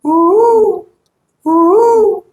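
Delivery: high falsetto ooh; high tweeter ooh in head voice. Shown as a demonstration. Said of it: "from the tweeter".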